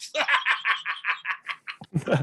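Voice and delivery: long, rolling villain's cackle; maniacal chaos laugh. Acted. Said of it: "He is laughing exactly like that".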